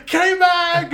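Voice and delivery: a huge high yell of a name; Ted Kravitz yelling. Retold or imitated. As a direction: bellow the name across the paddock. Ted Kravitz calling out excitedly.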